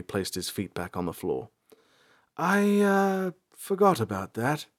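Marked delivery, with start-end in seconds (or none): 2.4-4.7 awkward, halting ranger voice; embarrassed, caught out; sheepish ranger. A narrator's reading. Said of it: "He cleared his throat".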